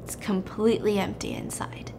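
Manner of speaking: soft suspenseful whisper. Real, not acted. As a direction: hush it like a twist. Suspense reveal in retelling.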